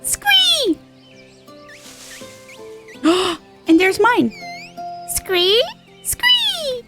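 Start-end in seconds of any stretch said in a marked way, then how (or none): 0.0-0.8 very high-pitched "squee"; mock creature squeal. Mimics serpent's squeal. 5.1-6.8 two high squees, rising then falling; mock creature squeals. Mimics serpent's squeal.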